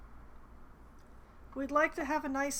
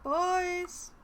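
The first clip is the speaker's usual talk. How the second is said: calling out; 6 semitones higher, 4 dB louder than usual. Acted. Calling out for missing boys.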